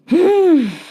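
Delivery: long loud exasperated sigh, falling; a weary sigh at the plot; big sigh. Real, not acted. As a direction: let out a heavy sigh. Exasperated sigh alone on line.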